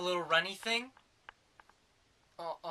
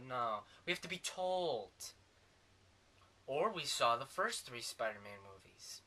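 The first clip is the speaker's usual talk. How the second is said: ordinary talk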